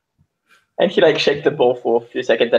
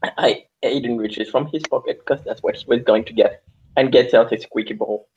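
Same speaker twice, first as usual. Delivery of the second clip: ordinary talk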